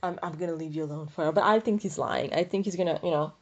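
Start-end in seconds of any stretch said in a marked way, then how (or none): none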